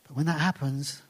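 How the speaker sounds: ordinary delivery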